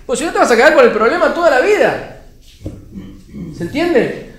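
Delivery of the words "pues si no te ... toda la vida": higher, louder, emphatic push; raised preaching voice. Real, not acted. Preacher urging people to keep praying, raised voice. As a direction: press the point loudly and high.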